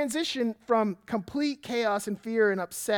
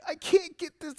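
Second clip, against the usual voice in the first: put-on whiny child; 9 semitones higher, 4 dB louder than usual. Daughter whining to father.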